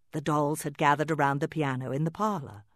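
ordinary delivery

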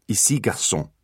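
Ici, garçon, gently in French; French phrase demo. Shown as a demonstration. Artemis models the French phrase for his brothers. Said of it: "Raise one finger and say".

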